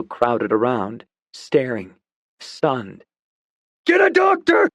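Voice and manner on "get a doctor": very high, loud shout. Acted. Dawson shouts at the crowd for help. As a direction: order the crowd, frantic. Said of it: "Dawson shouted at them".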